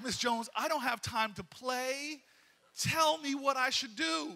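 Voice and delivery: slow, loud, exasperated demand; slow exasperated plea to the neighbour; impatient plea. Retold or imitated. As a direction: beg her to get to the point. Pleading impatiently with Miss Jones.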